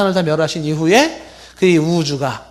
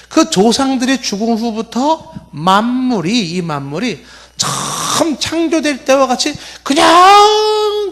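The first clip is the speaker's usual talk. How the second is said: high, slow scoffer with long-stretched words; mocking; 7 semitones higher, slower than usual. Preacher voices scoffers saying nothing ever changed, mocking. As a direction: drag out the key words derisively, pitched high. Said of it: "이렇게 조롱을 하는 거예요".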